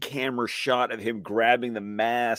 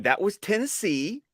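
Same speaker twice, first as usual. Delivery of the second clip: put-on a high, Tennessee-accented call; 9 semitones higher than usual. A Tennessee man spotting his home state's accent, in a Tennessee accent. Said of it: "I'm sitting here going".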